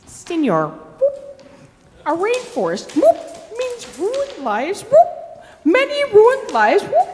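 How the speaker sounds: high, piping tree frog with little whoops; squeaky frog voice punctuated by whoops. Acted. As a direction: pipe it up like a tiny squeaky frog. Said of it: "In a squeaky voice".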